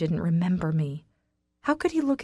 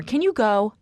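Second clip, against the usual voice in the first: "Can you go?" pushed higher and louder, fed up; angry; 3 semitones higher, 5 dB louder than usual. Acted. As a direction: snap it at him to leave. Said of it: "You're pissing me off".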